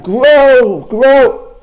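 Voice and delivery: high, loud drawn-out yell; full-force storybook toad shout; screaming. Acted. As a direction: scream down at the ground. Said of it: "First he screams".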